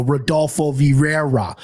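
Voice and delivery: Rodolfo Vieira in flat American Midwest sounds, no rolled R; Midwest pronunciation. Shown as a demonstration. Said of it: "my Midwest ass would go".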